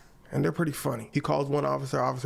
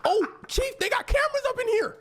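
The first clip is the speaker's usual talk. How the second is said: high-pitched alarmed call to the boss; squeaky, hammed-up alert; mock alarmed officer. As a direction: imitate a panicked officer calling out. Acts officer alerting chief about cameras.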